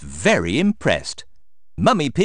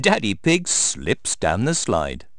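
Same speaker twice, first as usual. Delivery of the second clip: ordinary delivery, nothing marked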